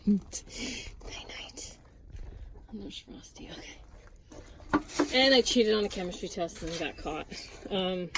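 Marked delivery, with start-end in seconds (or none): 0.1-5.1 hushed goodnight; whisper. Real, not acted. Woman whispering goodnight to the chickens. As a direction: breathe it softly.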